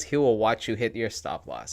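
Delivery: ordinary talk, nothing marked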